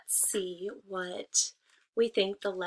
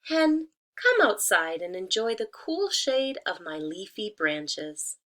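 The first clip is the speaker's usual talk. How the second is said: coaxing tree; 4 dB louder, slower than usual. Acted. Disguised wolf luring the hen outside, sweetly coaxing. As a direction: lure her out sweetly. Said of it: "said the tree".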